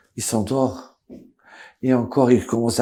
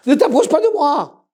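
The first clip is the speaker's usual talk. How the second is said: high, loud command to keep away; shouted warning; 7 dB louder than usual. Acted. Son shouts at the doctor not to come closer. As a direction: bark him back.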